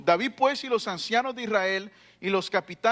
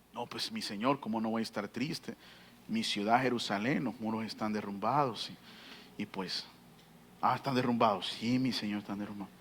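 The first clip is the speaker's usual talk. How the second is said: much lower, much softer mournful voice; sad Nehemiah; 12 semitones lower, 9 dB quieter than usual. Acted. Nehemiah answering the king, lower and softer in sorrow. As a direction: speak low and broken with grief. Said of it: "Y Nehemías dice".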